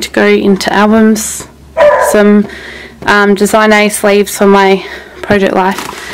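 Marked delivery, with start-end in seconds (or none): none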